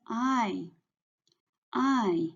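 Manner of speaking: "I" as Mandarin ai, gliding down; fourth tone. Shown as a demonstration. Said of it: "this is the fourth tone".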